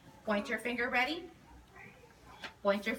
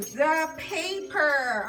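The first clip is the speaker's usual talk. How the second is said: slow modelled word; 2 semitones higher, 5 dB louder, much slower than usual. Shown as a demonstration. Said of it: "teacher models word for class".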